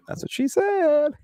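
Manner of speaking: high, stretched-out goofy delivery; silly high-pitched innuendo; jokey punchline. Pretend. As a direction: draw out the last word high. Repeats the dirty joke openly.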